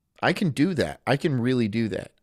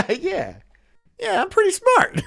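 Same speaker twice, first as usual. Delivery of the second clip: much higher, smug, pleased with himself; smug dupe. Retold or imitated. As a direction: act the satisfied sucker walking out. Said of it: "he walks out".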